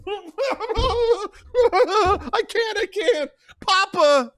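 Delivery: high loud panicky cry; panicked. Acted. Fredo crying out to Papa, fumbling the gun. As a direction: fall apart in panic.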